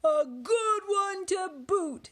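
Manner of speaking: drawn-out, high, loud chorus call; crew echoing heartily. A narrator's reading. Crew agrees with head pirate, loud high chant. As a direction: echo it slowly at full voice. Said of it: "the others agreed".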